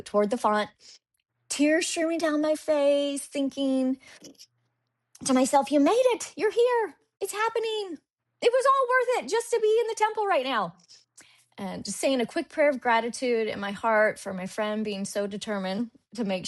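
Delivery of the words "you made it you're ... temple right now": high-pitched, thrilled self-talk; retold excitement. Thinking to herself by the font, retold joyful excitement. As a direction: marvel at it, pitched high throughout. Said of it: "I was so excited".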